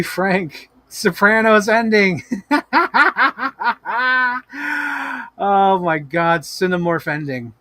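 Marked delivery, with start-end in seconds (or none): 2.3-5.4 high burst of laughter; real laughing fit. Real, not acted. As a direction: crack up at the joke. Laughs at Sopranos ending.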